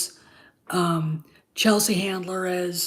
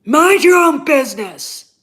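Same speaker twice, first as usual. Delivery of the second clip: loud retort; 7 semitones higher, 10 dB louder than usual. Real, not acted. Snapping back at rude commenter.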